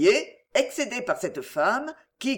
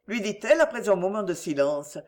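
usual speaking voice

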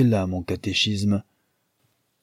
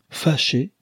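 a sharp, higher cry of outrage; indignant outcry; 6 semitones higher than usual. A narrator's reading. Rosamond, outraged by the maid's excuse, cries back indignantly. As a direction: fling the word back at her in disbelief. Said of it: "s'écria Rosamond".

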